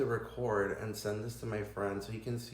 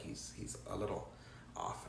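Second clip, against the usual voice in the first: softer, whispered confidence; hushed aside; 4 semitones lower, 11 dB quieter than usual. Retold or imitated. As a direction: let it slip like a secret. Superior's hushed confidential reply.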